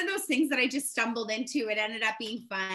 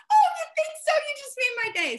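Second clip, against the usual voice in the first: very high, loud flattered squeal; delighted gushing; 8 dB louder than usual. Retold or imitated. Older woman thanking shopper for the compliment, delighted. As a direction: imitate someone thrilled by a compliment.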